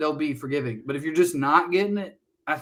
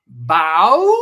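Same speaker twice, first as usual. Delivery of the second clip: put-on toddler voice; 9 dB louder than usual. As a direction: ask "Bow?" like a small Appalachian boy. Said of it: "he'll go".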